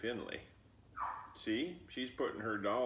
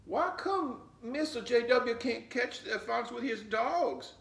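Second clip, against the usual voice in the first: put-on much higher, curious child voice; little girl asking; 14 semitones higher, 6 dB louder than usual. Flossie asking her grandmother about the fox, high and curious. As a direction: ask like a puzzled little girl.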